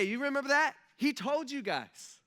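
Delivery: high, loud, insistent excitement; pumped angel. Pretend. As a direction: rub it in as an eager angel. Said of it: "this angel's probably pretty pumped".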